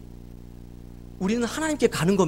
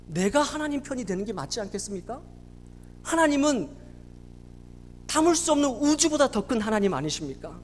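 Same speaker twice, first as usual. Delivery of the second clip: normal talking voice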